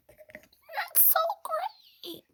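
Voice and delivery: high, slow, mocking "that's so great" with a laugh; sarcastic. Telling about a rival girl, sarcastically fake-happy. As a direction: drag it out, dripping with sarcasm. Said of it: "No, it's not".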